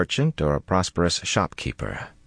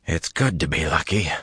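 hoarse, worn-out croak. Acted. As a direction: croak it out, spent after the fight. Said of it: "Matt croaked".

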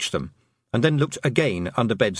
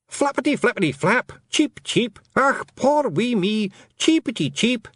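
high-pitched feagle squawking out fake birdsong; mock bird yelling; 13 semitones higher than usual. Feagle posing as a wounded bird to lure the cat, high mock yelling. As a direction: yell in a high, put-on Scots voice, playing a helpless bird. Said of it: "it yelled".

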